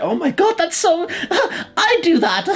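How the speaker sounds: high-pitched, gasping mock amazement; mock gushing test-taker. Mocking personality-test takers thrilled by their results, exaggerated gushing.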